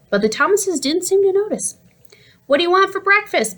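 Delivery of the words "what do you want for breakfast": higher pitched shouted question; mom shouting. A narrator's reading. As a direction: call out over the noise. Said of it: "Mom shouted".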